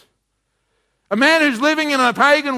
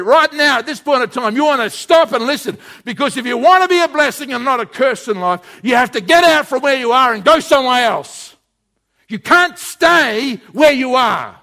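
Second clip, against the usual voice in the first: preaching; 3 semitones higher, 4 dB louder than usual. Acted. Preacher urging congregation.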